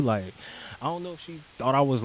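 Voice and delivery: ordinary talk, nothing marked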